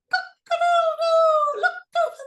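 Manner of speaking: loud high cockerel crow; rooster crow. Acted. Cockerel crows.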